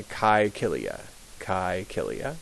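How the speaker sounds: Caecilia with hard k and eye; model name. Shown as a demonstration. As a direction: bite both c's as k. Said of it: "the A E is pronounced like an I".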